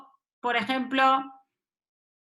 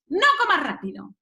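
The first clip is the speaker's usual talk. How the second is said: higher, quicker reprimand; scolding command; 6 semitones higher, faster than usual. Acted. Parent tells a child how to eat, scolding. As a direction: snap out a raised order.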